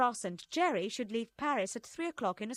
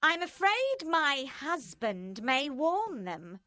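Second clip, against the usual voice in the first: higher, slow, foreboding; ominous; 5 semitones higher, 4 dB louder, slower than usual. A narrator's reading. Madame Defarge warning her friends, ominous and slow. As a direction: let the threat hang slowly. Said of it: "Madame Defarge said ominously".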